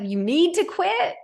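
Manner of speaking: high, slow disbelieving question; can't believe he wants to quit; incredulous mom. Retold or imitated. Mom questioning her son who wants to quit.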